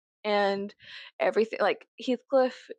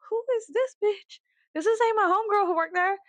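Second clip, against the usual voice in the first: mock indignant; 11 semitones higher than usual. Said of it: "And I was like".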